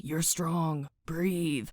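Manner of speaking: soft, low reassurance; gently steady someone, voice dropped; supportive, princely. Acted. Cal comforting spiraling Mare, soft supportive prince. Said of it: "being all supportive and princely".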